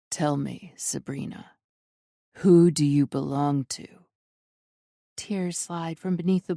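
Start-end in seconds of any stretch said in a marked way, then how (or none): 0.1-3.9 dark, deep, deliberate. A narrator's reading. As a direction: speak low and deliberate, in control. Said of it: "Dark, deep, deliberate".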